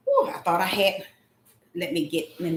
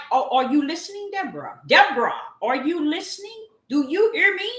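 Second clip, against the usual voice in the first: higher, rising, urgent questioning; insistent calling; 8 semitones higher, 4 dB louder, faster than usual. Retold or imitated. God asking Deborah to listen, insistent. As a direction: press the listener for attention, louder and higher.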